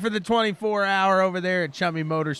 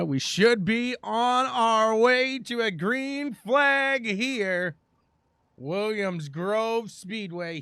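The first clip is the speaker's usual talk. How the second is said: drawn-out race-start call; live play-by-play; 6 semitones higher, much slower than usual. Real, not acted. Announcer calling the green flag at the speedway, slow, building race call. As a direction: stretch the key words as the race gets going.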